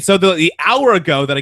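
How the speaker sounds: usual speaking voice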